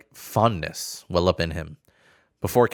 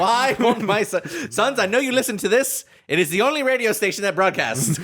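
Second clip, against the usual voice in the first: high comic announcer voice; 10 semitones higher than usual. Acted. Mock radio broadcaster.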